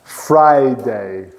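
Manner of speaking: Friday slowly separated as FRI... DAY; two-syllable Friday. Shown as a demonstration. Teacher reminding a student of two syllables, separated Friday demo. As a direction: give Friday two low, even beats.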